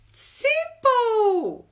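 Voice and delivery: squeaky high "Simple"; chirpy high. Acted. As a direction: pop it out bright and high. Chirpy punchline.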